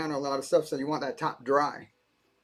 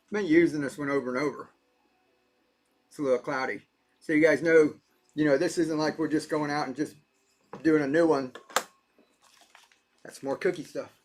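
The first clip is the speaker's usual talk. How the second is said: normal talking voice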